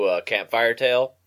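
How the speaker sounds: ordinary talk, nothing marked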